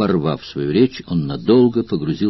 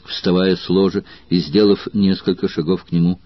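ordinary delivery

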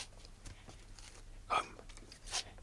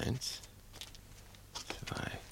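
flüsternd die Stufen mitzählen; leise zählen; 6 semitones higher, 8 dB quieter, slower than usual. Acted. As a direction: ganz leise, um niemanden zu wecken. (heimlich flüsternd Stufen zählen)

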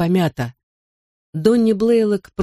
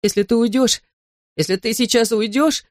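put-on higher-pitched, anxious little boy; choked-up child; 6 semitones higher than usual. A narrator's reading. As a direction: warn dad, struggling with the words. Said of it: "он с трудом выдавливал из себя слова".

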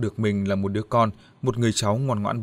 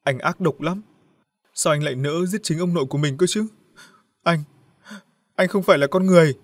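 choked up; 6 semitones higher than usual. Acted. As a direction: accuse the brother through a lump in the throat. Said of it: "giọng nghẹn ngào". (giọng nghẹn ngào trách móc anh trai)

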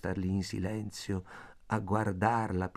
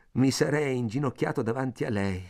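ordinary delivery

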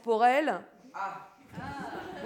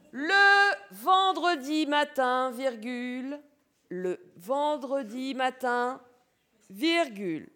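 dictating; 3 semitones lower, 4 dB quieter than usual. Shown as a demonstration. Teacher dictating, repeating.

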